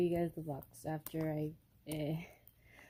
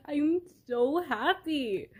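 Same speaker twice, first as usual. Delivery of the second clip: high, slow, squealing with real joy; overjoyed; 9 semitones higher, 3 dB louder, much slower than usual. Real, not acted. Vlogger gushing to viewers about her new game, giddy and slow. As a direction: gush it out, drawn-out and giddy.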